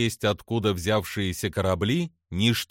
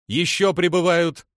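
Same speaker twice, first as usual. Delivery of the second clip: higher, louder urgent call-out; raised-voice alert from a shipmate; crew member reporting; 8 semitones higher, 5 dB louder than usual. A narrator's reading. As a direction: call out a new sighting to the crew. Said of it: "раздался голос Далву".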